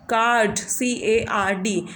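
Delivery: Card said slowly, then spelled letter by letter; slow spelled demo. Shown as a demonstration. Teacher showing the long आ in card, slowly. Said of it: "आ sound निकल रहा है card".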